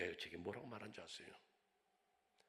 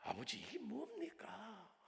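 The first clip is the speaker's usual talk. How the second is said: slow, higher mock question to father; daughter's protest; 12 semitones higher, slower than usual. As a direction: ask slowly, stress 뭡니까, lightly voiced daughter. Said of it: "이 생각을 했는지".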